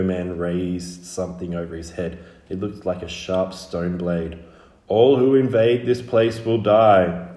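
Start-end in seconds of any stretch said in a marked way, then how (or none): 4.9-7.3 slow, loud, threatening proclamation; guttural shout. Acted. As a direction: shout it as a drawn-out death threat. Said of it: "he shouted in his guttural speech".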